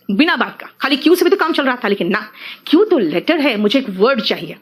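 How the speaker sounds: ordinary delivery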